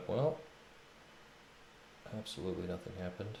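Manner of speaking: ordinary talk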